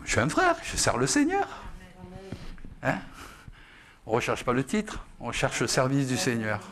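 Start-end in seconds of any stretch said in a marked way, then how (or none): 0.0-1.5 humble brother. Acted. Contrasts humble brother to pompous elder.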